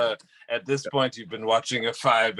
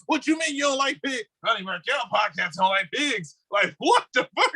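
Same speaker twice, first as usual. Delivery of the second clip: high, indignant squawk; mock animal rights activist; 9 semitones higher than usual. Animal rights people attacking the podcast, high and indignant. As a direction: accuse him in a high outraged voice. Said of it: "animal rights people like".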